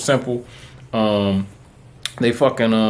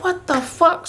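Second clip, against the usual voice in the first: incredulous outburst; slower than usual. Retold or imitated. Hearing he's denied again, very high incredulous cry. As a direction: voice shoots very high in outrage. Denied a second time on phone.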